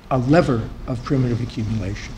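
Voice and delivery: lecturer's voice drifting low and soft; voice dropping down. Real, not acted. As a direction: let the phrase sink away quietly. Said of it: "go up instead of down".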